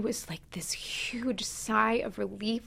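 hushed and breathless; whisper. Real, not acted. Sister recalling her brother's death, whispering.

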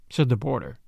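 usual speaking voice

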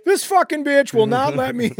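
high, loud comic outburst; high-pitched furious shout, hammed up; outraged rant. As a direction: yell it in mock outrage, high and hard. Acting out an outraged phone rant.

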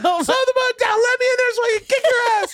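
imitated high-pitched shouted threat; helpless rage hammed up, yelled at the boat; furious Bobby. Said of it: "it's such an empty threat".

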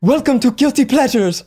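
attempted Gal Gadot accent that slips into a drug lord; fake Israeli accent. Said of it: "I tried to do her accent, and I kinda sounded like an African drug lord".

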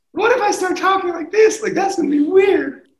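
squeaky-high, loud mock voice; high-pitched voice. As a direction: imitate a voice gone absurdly high after surgery. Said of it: "voice is gonna be really high-pitched".

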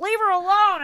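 high-pitched scolding call; acted-out indignant onlooker; bystander shout. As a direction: call out to stop someone.

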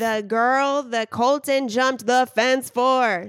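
loud, slow and emphatic; excited hype. Real, not acted. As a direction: sell the guest with big energy. Hyping the guest.

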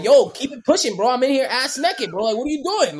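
high, loud re-enacted protest; indignant shooing. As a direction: tell off a gawker, mock outraged. Re-enacts shooing staring guards away.

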